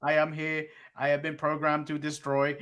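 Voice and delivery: robot voice. Retold or imitated. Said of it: "he didn't sound like a robot".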